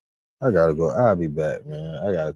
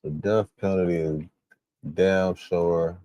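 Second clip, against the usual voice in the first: ordinary delivery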